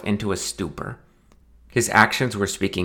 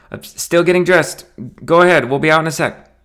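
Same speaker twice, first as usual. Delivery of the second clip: nervous call; 8 semitones higher, 5 dB louder than usual. Acted. Nervous best man calling out.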